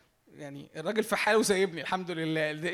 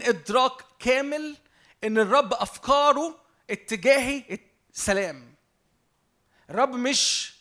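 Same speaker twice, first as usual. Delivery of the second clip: slow and louder, each phrase pressed home; slow fervent preaching; 3 semitones higher, 5 dB louder, slower than usual. Acted. Preacher to congregation about God's open gate, slow and louder. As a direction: proclaim it slowly like a new gate opening.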